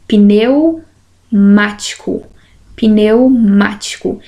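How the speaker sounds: "Pneumático" slowly, pneu held together, pause, then mático; pronúncia demonstrada. Shown as a demonstration. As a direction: show how "Pneumático" breaks after "pneu". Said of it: "Olha como eu pronuncio". ("Pneumático" devagar, com pausa depois de pneu)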